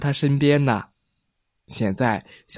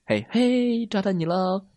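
playful call; 7 semitones higher, 5 dB quieter than usual. A narrator's reading. Hide and seek game.

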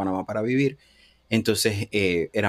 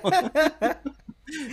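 laughing. Real, not acted. Laughs at own confession.

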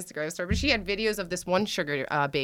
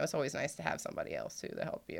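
sheepish murmur; 6 semitones lower, 13 dB quieter, faster than usual. Real, not acted. Sheepish trailing aside.

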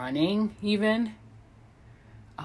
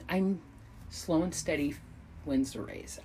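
ordinary talk

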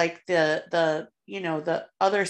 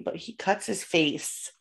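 ordinary talk, nothing marked

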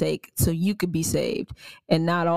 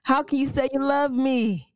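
higher, exasperated disbelief; indignant retort; 7 semitones higher than usual. Retold or imitated. Host voicing believer rebuking prejudice, higher incredulous. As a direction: call out the hypocrisy, incredulous. And it's just like.

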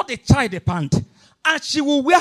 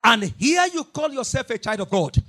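ordinary delivery

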